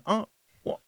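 startled, breathless half-words; stunned gasp. Retold or imitated. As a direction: caught off guard, stammer it out. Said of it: "And everyone's going".

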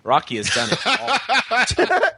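high, slowed, laughing mock-reading; mocking quoted tough guy. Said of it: "He provides a few answers".

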